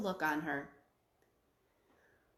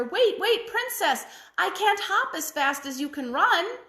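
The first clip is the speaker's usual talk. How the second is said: high, louder calling out; frog calling after her; 8 semitones higher, 4 dB louder than usual. Acted. Frog calling after the princess as she runs off, high and loud. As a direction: call after the running princess. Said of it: "the frog called after her".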